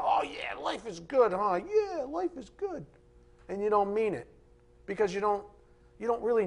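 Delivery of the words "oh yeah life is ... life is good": lightly mocking, empty breezy cheer with a high drawn-out yeah; mock hollow cheer. Preacher mocking a believer who doesn't mean it, hollow cheer. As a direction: parody someone who says it without meaning it. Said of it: "Don't just say that".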